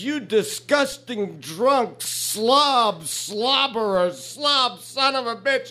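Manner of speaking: very high, drawn-out insults; hammed-up indignant woman, each insult stretched; outraged lady. Lady scolds the drunk who kissed her, high slow outrage.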